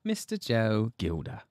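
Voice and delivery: light echo of "Mister Joe Gilder" in British; repeating the intro's "Mister" the British way; imitating the British accent. Host echoing Ben's intro in a light British accent. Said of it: "Oh, I love it".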